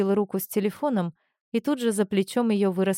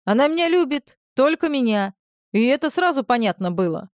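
loud, high, proud boasting; 5 semitones higher, 5 dB louder than usual. Acted. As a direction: brag about it loudly. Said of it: "мужского бахвальства".

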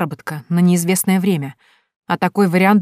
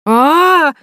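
high frightened scream; a startled shriek of fright; loud scream; 11 semitones higher, 8 dB louder than usual. A narrator's reading. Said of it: "раздался громкий вопль". (громкий испуганный вопль)

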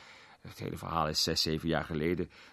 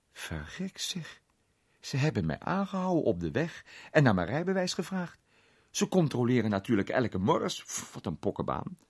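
raised, animated complaint, easing at the end; lightly voiced grumbling Redwood; 7 semitones higher, 4 dB louder than usual. Redwood complaining about being stopped on the road, mildly indignant. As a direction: grumble it high, with a puff of annoyance.

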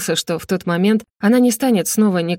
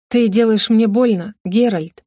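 ordinary delivery, nothing marked

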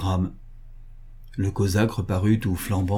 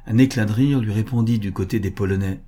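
ordinary delivery

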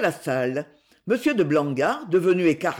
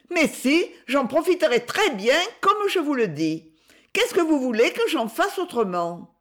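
put-on high-pitched child's voice; protesting boy; 7 semitones higher than usual. A narrator's reading. Jacques defends his plans to his elders, protesting. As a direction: object eagerly in a small boy's high voice.

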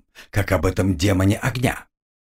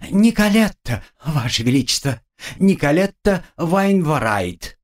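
sugary chirping; 7 semitones higher than usual. Acted. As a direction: chirp it like a sugary little doll. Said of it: "прощебетала сладеньким голоском".

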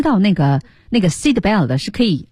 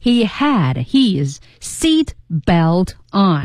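slow teaching read; much slower than usual. Shown as a demonstration. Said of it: "我们学过很多遍这个句型了".

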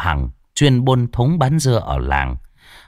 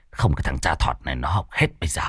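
fast; 7 semitones lower, 6 dB quieter, much faster than usual. Acted. Urging quickly.

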